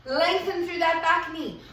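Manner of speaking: ordinary talk, nothing marked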